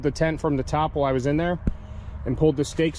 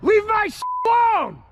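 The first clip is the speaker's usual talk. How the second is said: shouting; 13 semitones higher, 4 dB louder than usual. Real, not acted. Yelling at intruders by tent.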